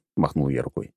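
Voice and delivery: ordinary delivery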